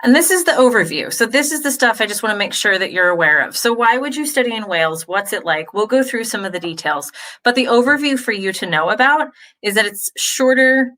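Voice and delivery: ordinary talk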